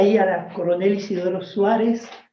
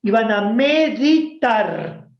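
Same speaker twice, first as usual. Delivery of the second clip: teacher's solemn phrase; 3 semitones higher, 4 dB louder, much slower than usual. Retold or imitated. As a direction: say it like the teacher's pious excuse. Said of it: "decía ella". (maestra solemne y lenta)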